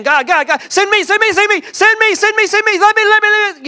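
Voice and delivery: high, loud, eager begging to be sent; eager pleading. Retold or imitated. Preacher voicing Isaiah begging God to send him, high and eager. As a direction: beg God to send you, arm in the air. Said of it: "and I think he's saying".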